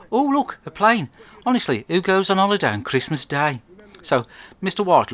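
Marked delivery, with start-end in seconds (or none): 0.1-3.6 high, bright mock amazement at the sky; Joe faking surprise. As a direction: pretend to spot a plane overhead. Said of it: "looks up at the sky and says".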